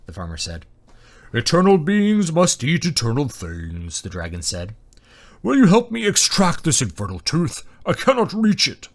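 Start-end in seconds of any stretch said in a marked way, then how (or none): 1.3-4.0 slow, grand dragon voice; ponderous, resonant beast; booming dragon. A narrator's reading. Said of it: "booming and deep". 5.4-8.9 loud, high-pitched dragon plea; booming, exasperated dragon; pleading dragon. A narrator's reading.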